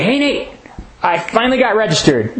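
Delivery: lightly acted enthusiastic hello; mock excited greeting. Acting out pastor's enthusiastic greeting.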